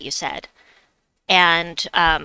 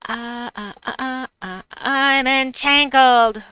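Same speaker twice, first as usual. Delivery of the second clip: frustrated groan; 5 semitones higher, 5 dB louder, slower than usual. Real, not acted. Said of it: "frustrated game moment".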